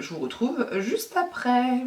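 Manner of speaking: usual speaking voice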